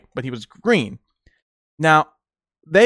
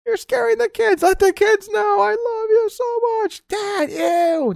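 very high falsetto voice; shrill-voiced hammed-up woman; mock girlfriend; slower than usual. Girlfriend scolding the boyfriend, very high falsetto. Mocking the shouting couple.

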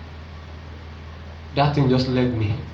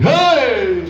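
high, drawn-out shriek of greeting; retold shout; 10 semitones higher, 4 dB louder than usual. Woman sees pastor walk in, imitated high shout. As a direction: cry out high in mock astonishment. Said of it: "They were shouting in the house".

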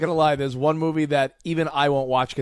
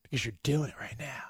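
hushed, voiceless aside; conspiratorial whisper; 4 semitones lower, 12 dB quieter than usual. Pretend. Co-host teasing the host about spilling tea, whispering. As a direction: whisper it as if sharing gossip.

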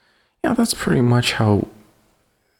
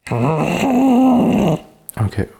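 Homer Simpson voice; 9 semitones higher, 3 dB louder than usual. Pretend. Testing the Homer voice.

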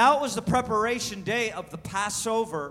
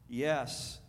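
ordinary delivery

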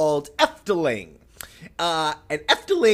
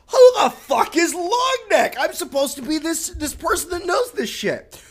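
shocked; 9 semitones higher than usual. Retold or imitated. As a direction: shout it in disbelief. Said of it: "And I was like".

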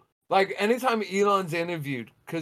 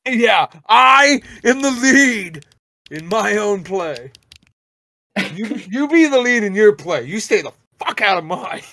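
louder mock-grand proclamation; grand declaration; 4 semitones higher, 9 dB louder than usual. Real, not acted. Claiming the lead role in his own life, mock grand. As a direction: proclaim it like a self-made hero.

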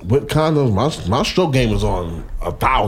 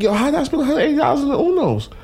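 incredulous; much faster than usual. Retold or imitated. Retelling own outraged reaction.

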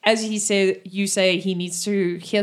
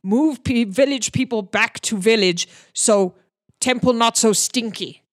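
orc broken speech. Acted. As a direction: count them off like a blunt brute. Orc listing problems in broken speech.

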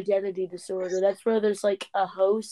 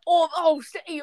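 high, exaggerated British accent; hammed-up British impression; mock British accent; 6 semitones higher, 3 dB louder than usual. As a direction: mimic an overdone British accent. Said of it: "You gotta love his really realistic accent".

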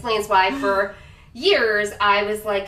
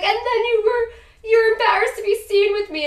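whiny Andie impression; 13 semitones higher, 3 dB louder than usual. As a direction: mimic her whining, pitched way up. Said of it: "she's like".

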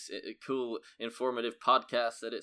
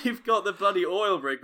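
ordinary talk

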